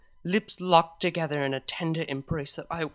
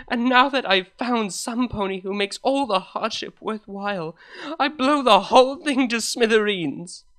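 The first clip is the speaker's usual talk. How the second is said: loud, rising self-reproach, louder as it goes; building from loud despair to near shout; anguished outburst; 4 semitones higher, 8 dB louder than usual. Acted. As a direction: blurt it out, furious at yourself. Hungover confession of her secret.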